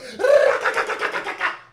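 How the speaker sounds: high, rapid cackle; cackling laugh. Real, not acted. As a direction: burst out cackling at the line. Laughs at her shooting at everyone.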